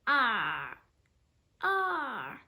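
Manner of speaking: loud growling monster yell; monster roar. A narrator's reading. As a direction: roar to scare someone. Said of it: "It jumped up and down and yelled".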